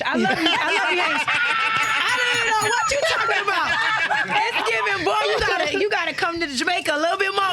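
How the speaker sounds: high, laughing Jamaican auntie teasing a celebrity. Acted. Roasting a celebrity's bad patois in a Jamaican auntie voice. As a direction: lay on the patois and laugh through it. Playfully rating [name]' patois.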